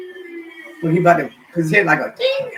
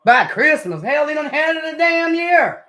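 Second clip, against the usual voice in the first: outraged; 10 semitones higher, 5 dB louder, slower than usual. Real, not acted. Outraged at mechanic's excuse.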